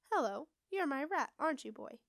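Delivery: swooping sing-song witch voice; strange sing-song. A narrator's reading. Witch greets the rat in a strange sing-song. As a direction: lilt it up and down, strangely. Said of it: "in a strange sing-song voice".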